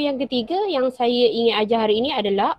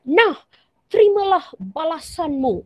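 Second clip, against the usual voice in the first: higher, sharp angry hand-over; marah; 6 semitones higher than usual. Shown as a demonstration. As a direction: give it back in anger. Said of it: "nada yang sedikit tinggi dan nada marah". (nada tinggi dan marah)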